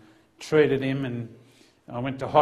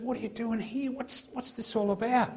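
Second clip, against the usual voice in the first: higher, incredulous questions; higher, quieter baffled asking; stunned disbelief; 9 semitones higher, 3 dB quieter than usual. Retold or imitated. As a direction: ask as if you can't believe it. Stunned officer questions ministry student.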